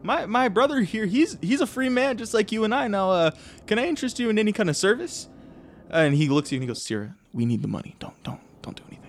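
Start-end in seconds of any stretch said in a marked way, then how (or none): 0.0-5.3 high-pitched, eager smooth-talker; placating negotiator. Acted. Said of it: "Killam comes up to you and goes". 6.8-9.0 urgent whispered aside to a brother; hushed warning. Acted. Brother quietly warns against attacking.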